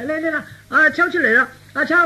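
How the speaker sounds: high-pitched, hurried summons; fast, high call to come out. Acted. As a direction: call for someone in another room. Father calls his son out.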